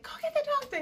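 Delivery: high mock yell at the husband; retold yell. Wife in labor ordering husband to fetch doctor, high mock yell. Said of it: "And so I yelled at him".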